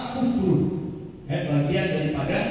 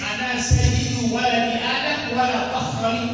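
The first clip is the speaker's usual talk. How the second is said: higher, slow chanted Arabic; a raised, sung-out recitation; recited hadith; 6 semitones higher, 3 dB louder, slower than usual. Shown as a demonstration. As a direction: intone the hadith.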